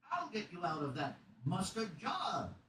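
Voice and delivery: high, drawn-out cartoonish voice; kids' YouTube character. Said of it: "some fucking guy on, on YouTube Kids".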